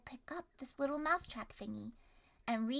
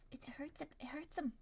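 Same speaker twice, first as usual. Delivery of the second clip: whispering; 11 dB quieter, faster than usual. Real, not acted. Whispered sympathy.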